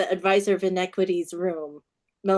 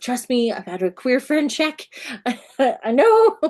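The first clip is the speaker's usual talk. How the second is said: higher retold plea, rising to a loud peak; emphatic reassurance; 5 semitones higher, 6 dB louder than usual. Author retelling her pitch to a reader, emphatic reassurance. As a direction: insist eagerly, building to a loud finish.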